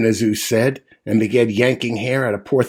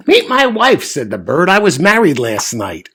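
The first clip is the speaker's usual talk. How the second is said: high, louder proud announcement; proud bird; 5 semitones higher, 4 dB louder than usual. Acted. Bird introducing his wife to the moose, higher and louder.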